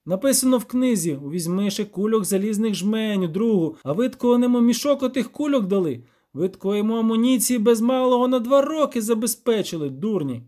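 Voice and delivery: loud, high indignant scolding; angry. A narrator's reading. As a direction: tear into them with mounting outrage. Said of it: "розсердився Любисток".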